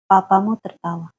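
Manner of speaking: plain reading voice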